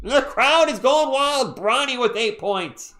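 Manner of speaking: high, loud and drawn-out; elated sportscaster, slow and high; hyped announcer call. Real, not acted. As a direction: stretch every word over a roaring crowd. Celebrating Bronny's basket.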